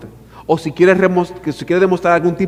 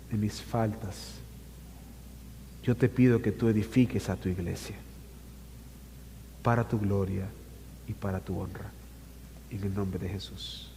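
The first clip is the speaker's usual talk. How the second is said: soft, low, humble prayer; hushed pleading to God; quiet prayer; 6 semitones lower, 12 dB quieter than usual. Real, not acted. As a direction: pray softly, head bowed.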